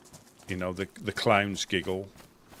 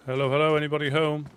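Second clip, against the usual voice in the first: loud, high call into the house; calling down a hallway; 7 semitones higher, 5 dB louder, faster than usual. Acted. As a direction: call out, hoping someone answers.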